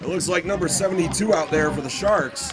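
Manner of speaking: loud, projected announcement; hockey announcer. Real, not acted. Rink announcer introducing a Sharks penalty shot, announcing.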